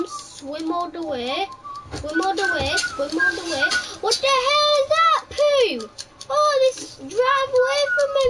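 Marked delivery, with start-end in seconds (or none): 4.0-8.2 high, loud indignant yelling at the cops; outraged yelling. Real, not acted. Gamer yelling at police on screen, outraged. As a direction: yell it at the fleeing cops, outraged. Raging at cops stealing boat.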